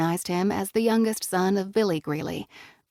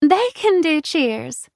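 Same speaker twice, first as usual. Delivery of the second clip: with a laugh; 9 semitones higher, 6 dB louder, much slower than usual. A narrator's reading. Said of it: "Mary Nell said with a laugh".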